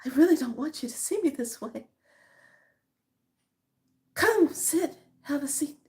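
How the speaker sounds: lightly voiced fifties film lady; mock vampire lady. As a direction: ham up a bitten heroine luring him in. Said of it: "and she says".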